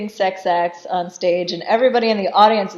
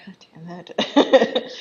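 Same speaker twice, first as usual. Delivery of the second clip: slow hushed whisper; a wife's weary whispered damn; whispered curse; slower than usual. Retold or imitated. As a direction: curse under your breath, slowly. Wife mutters under blame.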